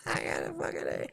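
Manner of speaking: very soft, quick voiceless wheeze; breathless whisper. Real, not acted. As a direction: whisper it, spent from laughing. Spent after long laughing fit.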